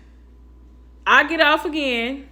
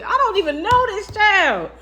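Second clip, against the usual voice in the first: exasperated disbelief; 9 semitones higher, 4 dB louder than usual. Retold or imitated. Exasperated about daughter's friend.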